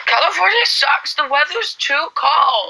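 high, drawn-out mock Californian; American accent. Playing a complaining Californian, high mock American accent. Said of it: "Someone said do American accents".

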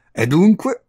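high, loud challenge; brooking no reply. A narrator's reading. Visitor cornering the countess, peremptory. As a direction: demand it as if final. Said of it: "con un tono che non ammetteva repliche".

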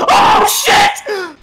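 high, loud panicked shout. Real, not acted. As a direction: yell it in shock. Chased by the killer.

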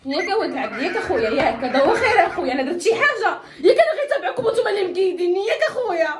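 loud, high denial through laughter; laughing denial. Acted. Man pleads to his friend he only followed them, loud laughing plea. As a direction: plead innocence while laughing.

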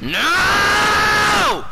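high, loud, long-held scream; despairing scream. Real, not acted. Gamer about to lose, long despairing scream.

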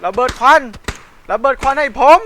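loud urgent call for smoke; shouting. Real, not acted. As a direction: yell to the squad. Calling for smoke grenade.

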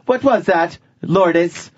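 high-pitched mock mother interrogation. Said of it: "[name] goes upstairs to her room".

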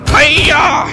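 high, loud karate-style yell; battle cry. Pretend. Player swinging at enemies in game, high battle cry.

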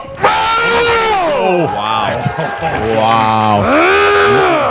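high shouted oh, wow; excited shout. Real, not acted. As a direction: yell in amazement. Commentator reacts to sudden win.

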